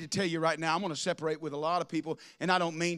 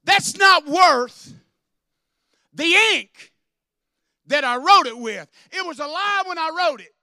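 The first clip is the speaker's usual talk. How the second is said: raised, loud preaching voice; preaching at full force; 11 semitones higher, 14 dB louder than usual. Acted. Pastor rails to his church, loud preaching. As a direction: hammer it home to the congregation.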